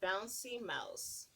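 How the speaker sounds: put-on lilting read-aloud to little kids; sing-song picture book narration; storybook reading. Reading a picture book to children, lilting.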